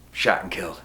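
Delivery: low, soft, matter-of-fact answer; understated, downbeat deadpan; deadpan reply. Acted. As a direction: drop it quietly, no big deal. Repeated casual reply about uncle.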